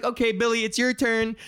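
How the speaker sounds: a hint of playground supervisor; calling a child up. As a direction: call a kid to take a turn. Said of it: "And it's like".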